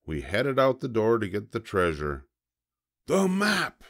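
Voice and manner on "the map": high, urgent pirate captain; Braidbeard. A narrator's reading. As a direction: demand it in a pirate voice. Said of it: "said Braidbeard".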